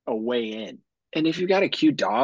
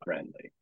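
friendly said low and much softer with innuendo; friendly dropped low and soft, suggestive; loaded friendly; 8 semitones lower, 13 dB quieter than usual. Shown as a demonstration. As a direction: lean hard on the hidden meaning of friendly. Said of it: "and I mean friendly".

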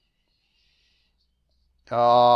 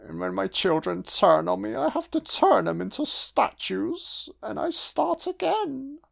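rising camp villain voice climbing ever higher; Frank-N-Furter impression; 8 semitones higher than usual. Ranting villain explains turning his children into statues, camp and soaring. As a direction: climb into a theatrical, preening monologue.

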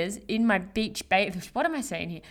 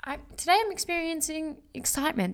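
demo excitement; 8 semitones higher, faster than usual. Shown as a demonstration. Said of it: "let's go, like".

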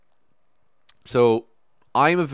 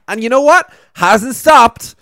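exasperated; 13 semitones higher, 11 dB louder than usual. Real, not acted.

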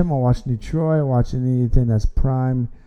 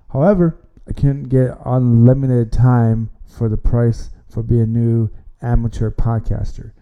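normal talking voice

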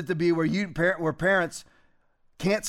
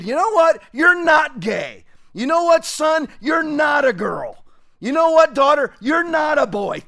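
loud, higher, forceful declarations; firm fatherly shout; 6 semitones higher, 6 dB louder than usual. Retold or imitated. Father shouting at his child, telling him what he is. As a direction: lay down the law to your kid at full volume. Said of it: "man up and tell your kid".

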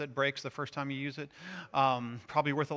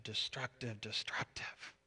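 fading to a bare whisper, repeating the word destructive; whispered dad; 5 semitones lower, 13 dB quieter than usual. Retold or imitated. Dad whispering over the scratched car, fading whisper. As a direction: whisper destructive, destructive, head down in dismay. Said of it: "duck his head and go".

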